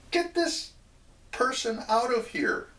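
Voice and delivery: high-pitched, indignant command; acted indignant order. Landlord to his servants about the crazy man, high indignant order. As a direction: call out to servants in a high, outraged voice.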